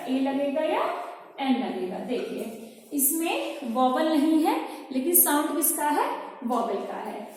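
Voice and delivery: normal talking voice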